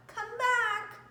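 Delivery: a final plea after the puppy; calling out. Acted. Family calling the puppy back, falling call.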